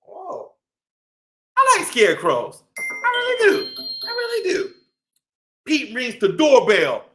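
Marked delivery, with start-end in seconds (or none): none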